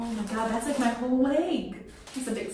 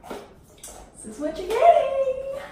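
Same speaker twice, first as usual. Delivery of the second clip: mock grand reveal; 7 dB louder, much slower than usual. Real, not acted. Showing off the bad bathroom setup.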